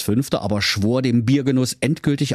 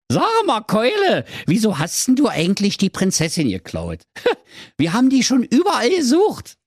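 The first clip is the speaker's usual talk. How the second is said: high, daft Berlin prince voice; silly prince; 12 semitones higher than usual. Acted. Prince diagnoses the dragon's heartburn, high daft voice. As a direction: play the dopey prince, high.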